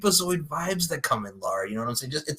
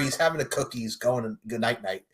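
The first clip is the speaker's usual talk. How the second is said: ordinary talk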